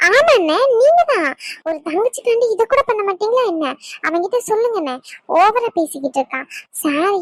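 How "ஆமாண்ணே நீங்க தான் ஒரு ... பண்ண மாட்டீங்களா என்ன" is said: eager goading. Acted. Sister goading brother to intervene.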